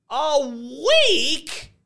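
high shocked question; can't believe the wait; dismayed. A narrator's reading. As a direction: ladies dismayed at the delay. Ladies protesting the delay.

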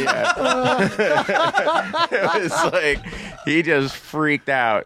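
ordinary talk, nothing marked

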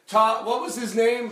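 high, pressing repeated question; mock Todd nagging for the name over and over; impatient Todd. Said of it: "and then Todd was like".

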